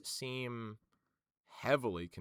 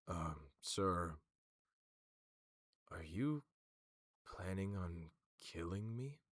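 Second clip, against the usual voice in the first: hesitant, halting, low-key question; Herald; 3 semitones lower, 3 dB quieter, slower than usual. Acted. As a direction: ask cautiously, drawing it out. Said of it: "the Herald, like, looks over".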